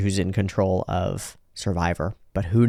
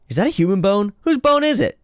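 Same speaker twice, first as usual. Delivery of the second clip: squeaky high voice; 10 semitones higher than usual. Acted. Sports Maxx asks about bone.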